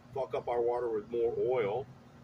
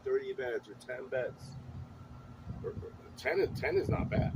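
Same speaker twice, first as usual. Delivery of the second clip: usual speaking voice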